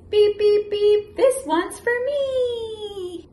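triumphant little truck; excited truck. Acted. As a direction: sing out the ending joyfully. Truck's climax line.

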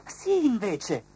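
high, slow butterfly voice; gently high and unhurried; butterfly's voice. A narrator's reading. Butterfly answering the friends, high and slow. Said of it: "disse la farfalla".

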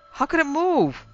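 high, wide-eyed innocent question. A narrator's reading. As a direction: play dumb sweetly. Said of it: "I asked innocently".

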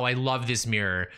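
ordinary delivery

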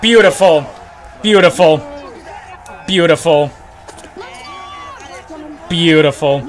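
normal talking voice

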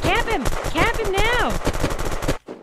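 loud, high urgent yell; urgent shout. Real, not acted. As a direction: yell at them to shoot. Urging teammate to shoot.

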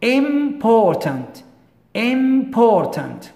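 important said very slowly, syllable by syllable; careful syllables. Shown as a demonstration. Teacher modelling important for students, slow and syllabic. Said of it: "We have two syllables".

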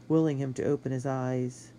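ordinary delivery

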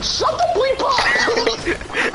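put-on laughing high Brooklyn-accent yell, very slow; hammed-up Brooklyn guy shouting through laughter; mock Brooklyn yell. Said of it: "The Brooklyn guy".

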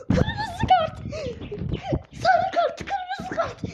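high shrieking yell for a card; screaming demand. Real, not acted. Furious player screaming for a red card at the ref. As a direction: scream it at the screen.

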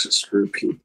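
normal talking voice

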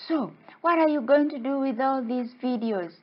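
high, inquisitive fish voice; little guy. Acted. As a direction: play a small creature quizzing a visitor. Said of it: "this little guy came over".

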